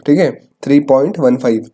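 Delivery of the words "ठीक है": usual speaking voice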